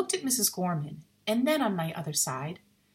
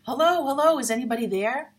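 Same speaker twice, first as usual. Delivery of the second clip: loud, high calling out; shouting; 7 semitones higher, 7 dB louder than usual. A narrator's reading. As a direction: call out into the dark house. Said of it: "young Reggie's voice shouting".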